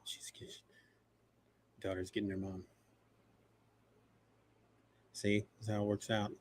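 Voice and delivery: near-whispered, low murmur; hushed aside. Real, not acted. Host quietly explaining his daughter is getting her mom, hushed aside. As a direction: keep it very soft, off to the side. Aside while off-mic interruption happens.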